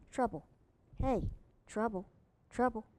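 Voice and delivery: plain reading voice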